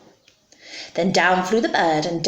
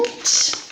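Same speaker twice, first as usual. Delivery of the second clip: sharp stage gasp; dramatic gasp. Pretend. Storyteller sees trouble coming for the broom, mock gasp. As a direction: suck in air in mock alarm. Narrator reacts to impending mishap.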